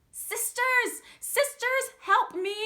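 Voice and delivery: high, loud cry to her sisters; the stuck girl calls to her sisters, loud and high; calling for help. A narrator's reading. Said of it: "she called".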